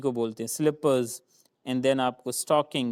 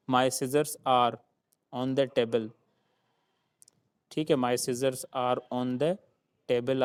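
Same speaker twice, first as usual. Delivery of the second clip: my scissors said slowly, word by word; slow teaching read; much slower than usual. Shown as a demonstration. Teacher slowly dictating an example to students. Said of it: "example sentence".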